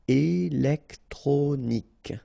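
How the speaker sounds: slow "Électronique", é-lec-tro-nique; syllable by syllable. Shown as a demonstration. French teacher modelling for learners, "Électronique" slowly syllable by syllable. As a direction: separate all four syllables of "Électronique".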